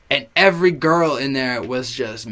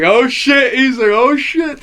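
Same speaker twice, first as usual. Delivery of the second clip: much higher and louder hyped shout; hyped friend; 9 semitones higher, 7 dB louder, slower than usual. Retold or imitated. [name] hearing In the Club come on, higher and louder. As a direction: yell it like the beat just dropped. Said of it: "[name] was like".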